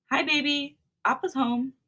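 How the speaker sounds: a light tender coo at the belly. A narrator's reading. Husband greets baby in the belly, lightly cooing. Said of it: "I coo".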